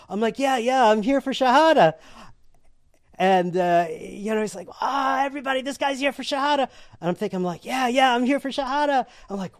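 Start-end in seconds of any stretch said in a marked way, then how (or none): 0.4-1.9 high, loud, excited yes; eager agreement. Retold or imitated. Newcomer playing along with the delighted worshipper, eagerly. 4.8-6.7 high-pitched calling to the group; excited announcement. Retold or imitated. Worshipper telling everybody about the newcomer, excited. 7.6-9.0 high, excited confirmation; eager playing along. Newcomer confirming to everybody why he came, eagerly.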